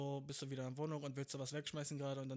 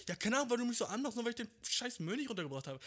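loud, higher and fast; angry shout; 7 semitones higher, 5 dB louder, faster than usual. Acted. Partner yelling about the trash. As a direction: fire it back, fully escalated. Acted quarrel escalates.

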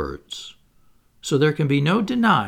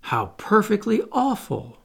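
higher, appalled little exclamation; dismayed sympathy; 8 semitones higher than usual. Acted. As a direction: gush with pity at the awful news. Wendy sympathises with Peter's plight.

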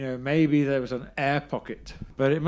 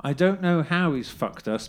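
normal talking voice

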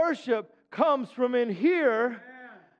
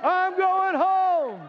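much higher, very slow drawn-out cry; preaching at full force; 9 semitones higher, much slower than usual. Real, not acted. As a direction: soar on every word. Refrain climax.

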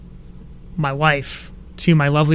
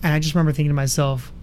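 ordinary talk, nothing marked

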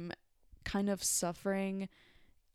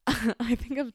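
laughing while talking; words through a laugh; 6 semitones higher, 9 dB louder, slower than usual. Real, not acted.

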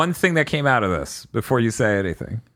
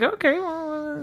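mock high hesitation; 4 dB quieter, slower than usual. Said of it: "And I'm like".

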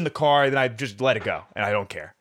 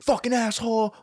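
mock angry yell; 10 semitones higher than usual. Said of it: "it was a fucking screaming match".

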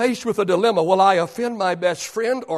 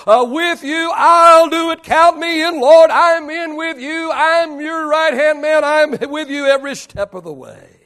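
mildly louder, eager boasting disciple; a lightly voiced Peter, bragging loyalty; emphatic Peter; 9 semitones higher, 9 dB louder than usual. Peter promising Jesus he'll never leave, mildly emphatic boast. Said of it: "he said".

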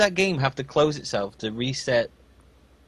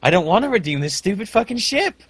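exasperated rant; 7 semitones higher than usual. Retold or imitated. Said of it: "And I was like".

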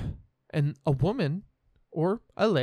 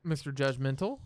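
ordinary delivery

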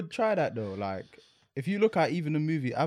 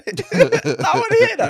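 slow, much higher laughing taunt; 13 semitones higher, 6 dB louder, slower than usual. Real, not acted. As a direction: tease him while laughing.

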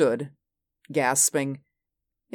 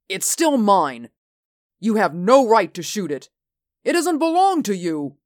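raised, higher, insistent objection; indignant protest; 5 semitones higher, 3 dB louder than usual. A narrator's reading. Peterson telling Franco not to shoot his wub, indignant. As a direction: argue hard, pitch climbing towards the end.

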